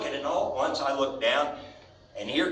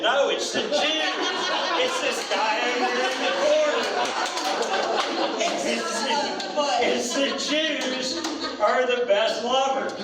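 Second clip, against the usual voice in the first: quoted cowgirl; 8 semitones higher than usual. Retold or imitated. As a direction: play her eager punchline, pitched up.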